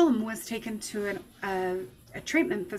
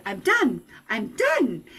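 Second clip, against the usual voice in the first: angry; 4 dB louder, slower than usual. Retold or imitated. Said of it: "And I got mad at him".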